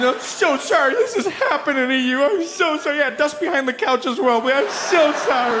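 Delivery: high, weepy hammed-up sorry; fake-sob an apology to a slave; mock crying apology. Imagined slave owner apologizing while crying, hammed-up and high. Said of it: "Just be crying".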